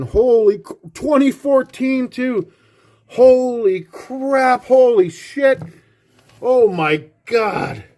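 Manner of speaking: overwhelmed fan losing it over a dream find; ecstatic shock. Real, not acted. Fan unboxing a Stanley Cup year autograph, ecstatic and drawn out.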